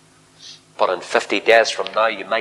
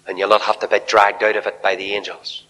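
ordinary talk

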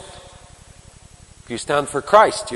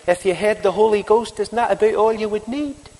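soft Scottish lilt, higher; quiet Scottish voice; 7 semitones higher than usual. Retold or imitated. Newspaper minister answering the young preacher, soft Scottish. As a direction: mildly imitate a gentle Scottish minister. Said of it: "this quiet little Scottish voice said to me".

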